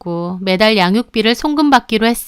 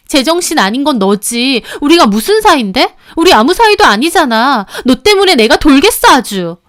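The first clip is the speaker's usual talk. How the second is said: higher and louder, fed up; furious retort; 5 semitones higher, 5 dB louder than usual. Acted. Mistress tears into the husband, furious. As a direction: snap back, building to a yell. Quarrel in recorded phone call.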